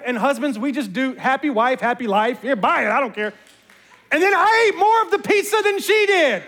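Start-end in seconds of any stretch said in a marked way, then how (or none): 4.1-6.4 laughing confession. Real, not acted.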